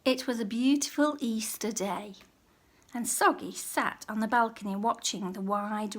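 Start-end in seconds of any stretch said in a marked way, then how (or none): none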